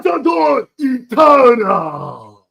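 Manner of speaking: high, slow triumphant wail; Kogami. Acted. Co-host as Kogami announcing the new form, high drawn-out cry. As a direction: draw the name out into a grand shout.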